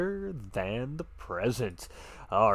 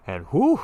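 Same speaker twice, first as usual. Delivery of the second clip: loud whew; 6 semitones higher, 10 dB louder than usual. Real, not acted. Exhale after fireworks at finish.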